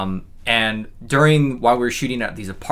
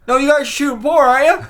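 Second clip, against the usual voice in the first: high, knowing mock insistence; hammed-up sly accusation; insistent neighbor; 7 dB louder than usual. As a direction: push it high like you caught them out. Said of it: "He goes".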